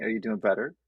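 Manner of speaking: ordinary talk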